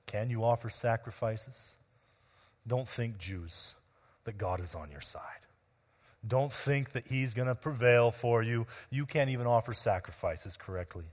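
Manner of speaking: understated taunt, soft and low-key; lightly acted, hushed scorn; mocking their faith. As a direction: voice the enemy's doubt quietly, with mild mockery. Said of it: "They mock their faith".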